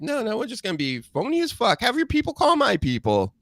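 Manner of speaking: high-pitched mock phony voice; hammed-up West Coast phony; phony West Coast type. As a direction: put on a fake, high showbiz voice. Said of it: "they're just like".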